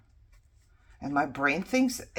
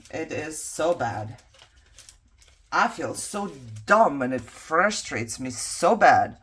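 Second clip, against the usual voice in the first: usual speaking voice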